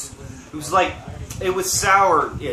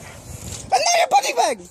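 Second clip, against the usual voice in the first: high, slowed mock whine; mocking echo; slower than usual. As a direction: parrot him in a squeaky voice. Echoes friend mockingly.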